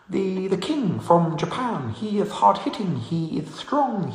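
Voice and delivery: Chris Eubank impression. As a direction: ham up Chris Eubank praising both fighters. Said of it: "Do your Chris Eubank impression".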